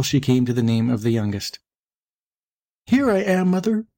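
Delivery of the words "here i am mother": put-on high, small young-kid voice; little voice crying out. A narrator's reading. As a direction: call to mother as a tiny hidden kid. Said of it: "a little voice cried".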